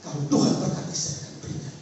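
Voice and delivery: whispered inner promise; inner vow. Retold or imitated. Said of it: "Dalam hati". (berbisik dalam hati)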